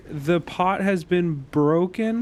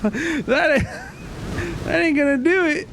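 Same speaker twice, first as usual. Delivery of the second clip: laughing; 8 semitones higher than usual. Real, not acted. Laughing at weak damage.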